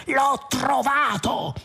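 high, drawn-out cry of discovery; triumphant cry. A narrator's reading. Zaphod announcing his find on the bridge, crying out. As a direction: cry it out slowly in high-pitched triumph, without extra volume. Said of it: "gridò Zaphod, battendo le mani".

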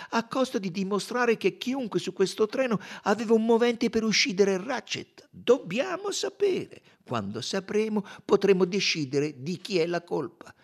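high, emphatic, determined detective; urgent detective. Acted. Detective urging his friend to find the truth, determined. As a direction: press the need to know, drawing out key words.

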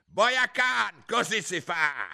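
very high, loud, slow royal screech; shrieking queen. A narrator's reading. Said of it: "strillò la regina".

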